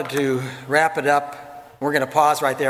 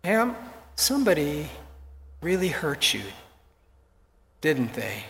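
soft, tender question; hushed caring words; gentle; 5 dB quieter than usual. Retold or imitated. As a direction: reach her gently. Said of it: "And so I said".

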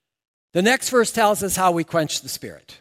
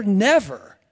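second "never" punched louder; a louder, pressed "never"; emphatic never; 3 semitones higher, 6 dB louder than usual. Acted. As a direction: hit "never" hard the second time.

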